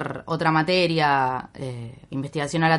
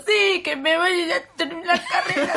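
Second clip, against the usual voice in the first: 1 laughing high mock despair; 12 semitones higher than usual. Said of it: "Y yo me siento".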